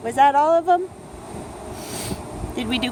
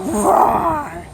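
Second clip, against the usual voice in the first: playful roar; 6 semitones higher than usual. Acted. Parent echoes the bear roar.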